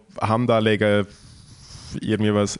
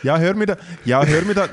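raised, indignant protest; 6 semitones higher than usual. Real, not acted. Protesting friend's teasing.

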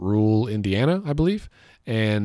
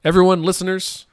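calling out; 10 semitones higher, 6 dB louder than usual. Real, not acted. Calling out to the listeners.

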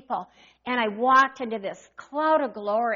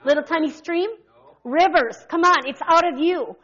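fervent preaching; 3 semitones higher, 5 dB louder than usual. Acted. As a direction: call it out to the congregation. Preaching call and response.

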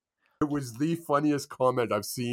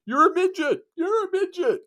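high mocking sing-song taunt, much higher; taunting heckle. Player heckling an opponent off the field, high mocking taunt. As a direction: jeer it up high like a schoolyard bully. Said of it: "he was going off the field going".

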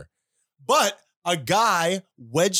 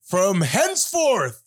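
town crier; 7 semitones higher, slower than usual. Acted. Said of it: "now I am unrolling my scroll".

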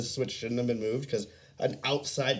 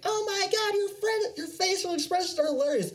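put-on high girly squeal; high delighted girl voice; imitating a girl amused at his face; excited girl. Quoting girl laughing at him.

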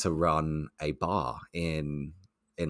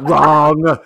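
much louder, higher game-show-style buzz-off; loud rejection; 8 semitones higher, 13 dB louder than usual. Retold or imitated. Rep shooting down the new guy's answer, loud. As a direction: blast the one word out as a verdict.

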